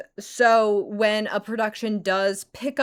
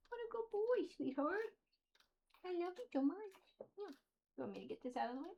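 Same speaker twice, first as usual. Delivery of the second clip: squeaky praising a dog; 9 semitones higher, 6 dB quieter, faster than usual. Real, not acted. Speaker turns to talk to dog.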